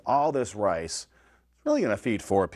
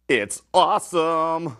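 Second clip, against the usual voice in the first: excited rave; 7 semitones higher, 6 dB louder, slower than usual. Real, not acted. Raving about his dish.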